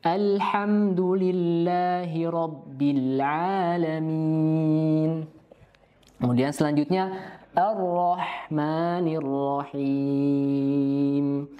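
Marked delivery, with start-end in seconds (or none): 0.0-5.4 very slow chanted verse; Quran recitation. Shown as a demonstration. 7.6-11.5 very slow chanted verse; Quran recitation. Shown as a demonstration.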